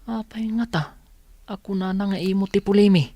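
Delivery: put-on high child asking mother; child voice pleading with nana; child's voice. Child voice replies.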